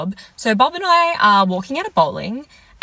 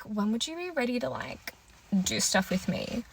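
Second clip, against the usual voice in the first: much softer, imitating an awkward hushed ask; sheepish mumble; 13 dB quieter than usual. Awkward question, voiced boyfriend.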